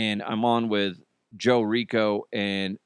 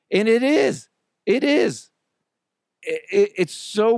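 emphatic agreement; 9 semitones higher, 4 dB louder than usual. Real, not acted. Emphatic agreement with listener's praise.

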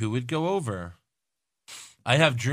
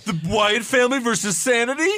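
joking; 10 semitones higher than usual. Pretend. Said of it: "I'm just kidding".

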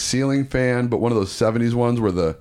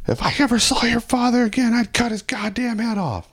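much higher, put-on old grandma voice; grandma's threat; 14 semitones higher than usual. Grandma vowing to behead the father, high put-on grandma voice. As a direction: play grandma swearing revenge. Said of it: "she'd always be like".

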